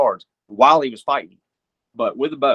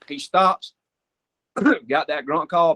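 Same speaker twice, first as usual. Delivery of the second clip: ordinary delivery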